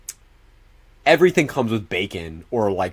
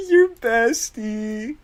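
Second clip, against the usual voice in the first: much higher, slow, drawn-out mocking lilt; teasing singsong; 12 semitones higher, much slower than usual. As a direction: stretch it high and slow to tease. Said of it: "I was gonna say".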